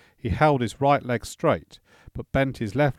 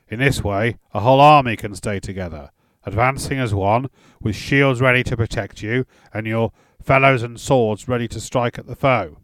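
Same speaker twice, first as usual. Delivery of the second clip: loud, rousing warrior instructor; commanding drill voice; 8 dB louder than usual. A narrator's reading. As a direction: proclaim it like a battle lesson. Grettir lectures on army tactics.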